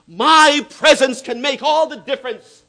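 preacher voicing Jesus with force; proclaimed. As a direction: ring it out as Jesus.

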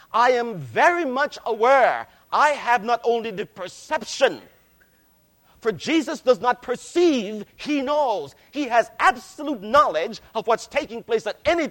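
loud, ringing pulpit voice; full-force preaching. Acted. Preacher booming Christ's words to the church. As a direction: preach it out over the congregation. Preaches the Laodicea letter loudly.

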